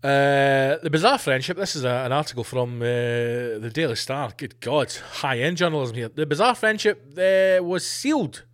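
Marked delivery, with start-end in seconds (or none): none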